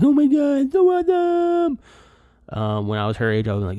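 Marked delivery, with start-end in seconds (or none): 0.0-1.8 very high, loud mock squeal of delight; gleeful high-pitched self-parody; over-the-top excitement. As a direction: shout it like a hammed-up thrilled tourist. Exaggerates his delight at a waterfall.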